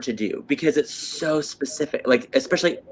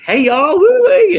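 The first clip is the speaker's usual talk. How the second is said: higher and much louder, a whooping Southern country twang; hammed-up Southern country accent; 10 dB louder, slower than usual. Showing how she slips into a Southern country accent around country folks. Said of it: "when I'm around any one country, I'm like".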